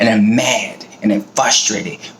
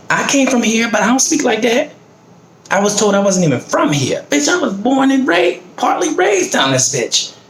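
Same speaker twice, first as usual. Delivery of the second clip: high, heated protest; pitched way up in outrage; indignant; 12 semitones higher than usual. Real, not acted. Protesting to people who doubt where she's from, indignant and high. Angry rant about being doubted.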